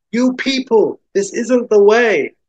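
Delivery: high-pitched imploring woman's voice; high, urgent character plea; woman pleading. Acted. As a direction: appeal to an advancing mob. Isabella pleads with advancing armed diners.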